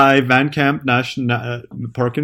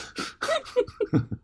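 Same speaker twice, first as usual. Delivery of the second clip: shrill burst of laughter; high squealing laugh; 11 dB quieter than usual. Real, not acted. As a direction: shriek with laughter.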